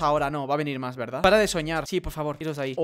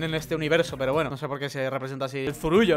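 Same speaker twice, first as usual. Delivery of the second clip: usual speaking voice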